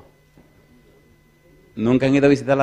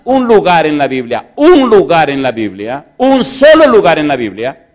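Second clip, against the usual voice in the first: repeated phrase growing louder, peaking on solo; building emphasis; 5 semitones higher, 14 dB louder than usual. Acted. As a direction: build it up each time. Building repetition.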